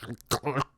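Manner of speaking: mock gurgling choke; foaming at the mouth. Acting out the child foaming at the mouth, mock choking. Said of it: "foaming at the mouth".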